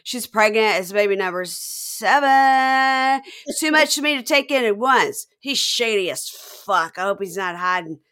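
put-on loud, slurred drunk mom voice; slurring drunk. Imitating the drunk mom at the engagement, loud slurring. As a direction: slur it out like a tipsy mother. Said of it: "She's so slurring".

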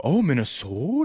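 a hint of an outsider teasing Minnesota; mock Minnesota accent. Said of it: "first thing people do say about Minnesota".

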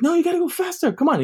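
high-pitched, pushy imitation of uncle; mock drill-sergeant hurrying someone along; urging drill-sergeant uncle. Uncle rushing nephew cleaning fish, high and pushy. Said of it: "He's treating this like the military".

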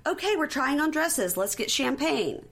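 high, bubbly saleslady imitation; shop lady. Retelling the shop lady's staged champagne offer, high and bubbly. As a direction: chirp it like an eager saleswoman. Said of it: "So the shop lady then says".

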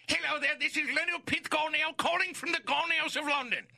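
high-pitched comic London caller; cartoonish announcer from London; character voice. Shown as a demonstration. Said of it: "you do your character voice".

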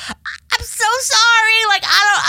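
high, teary, pleading apology; choked-up high sorry; near tears. Retold or imitated. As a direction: retell it on the verge of tears, voice up high. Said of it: "Were you crying?".

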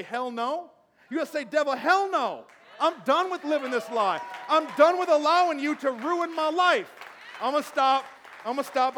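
full-force preacher's shout, high and very loud; mad, defiant shout. Acted. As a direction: roar defiance at the devil. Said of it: "you've gotta get mad".

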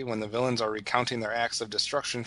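ordinary talk

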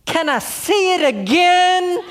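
high, loud, slow and laughing; a delighted girl shouting to the bald woman across the room; excited child shout. Retold or imitated. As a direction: call across the restaurant like a thrilled little girl. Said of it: "shouts out in the whole restaurant loud".

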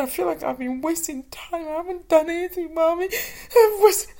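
high, breaking into real-sounding cries at the end; imitating a crying child. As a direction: voice the daughter weeping and rushing. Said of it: "crying even more and more".

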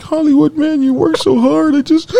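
very high, whiny complaining voice; high mock whine about being tired; whiny self-quote. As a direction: whine like a worn-out kid. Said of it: "I was like".

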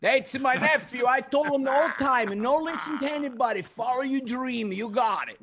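high, put-on voice of an uncle taking credit; boastful relative. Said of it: "they'll take credit".

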